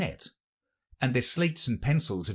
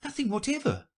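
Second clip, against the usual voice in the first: put-on very high girlish reply; high, unforced storybook girl voice; young Alice. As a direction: answer as little Alice, high-pitched. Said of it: "said Alice".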